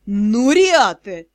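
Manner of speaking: slow, drawn-out groaning reproach; exhaled exasperation. A narrator's reading. Stunned magister just cursed by student, exasperated exhale. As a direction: stretch the name out as you breathe it. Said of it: "и только выдохнул".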